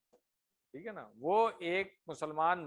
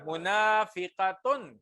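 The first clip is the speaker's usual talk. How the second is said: slow model answer; 4 dB louder, much slower than usual. Shown as a demonstration. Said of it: "اس کی مؤنث کیا بنے گی".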